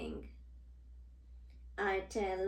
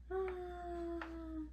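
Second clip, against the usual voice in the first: falling cry; 9 semitones higher, 7 dB quieter than usual. Acted. Falling off the flower.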